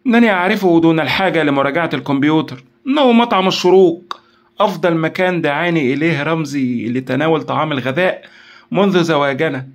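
high, loud eager exclamation; excited cry. A narrator's reading. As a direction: blurt out the answer triumphantly. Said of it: "تألقت عين نشوى وهي تهتف".

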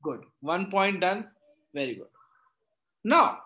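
ordinary talk

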